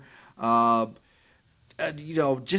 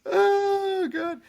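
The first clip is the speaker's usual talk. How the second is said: high squeal; 4 dB louder than usual. Real, not acted. Laughing cringe after frat voice.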